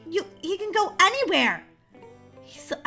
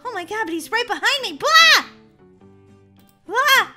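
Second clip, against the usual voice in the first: scared horror game streamer; 7 semitones higher, 7 dB louder than usual. Real, not acted. Chased by doctor.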